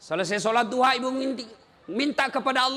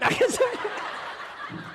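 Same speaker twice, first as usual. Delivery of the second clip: high unvoiced giggle; 12 semitones higher, 3 dB quieter than usual. Real, not acted. Laughs at his story.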